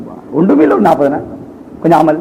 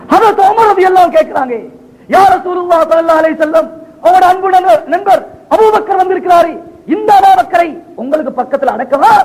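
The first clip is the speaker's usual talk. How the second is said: high, ringing plea; impassioned preaching; 8 semitones higher than usual. Acted. Preacher voicing Umar asking permission at the Prophet's tomb, impassioned. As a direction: call it out high, phrase by phrase.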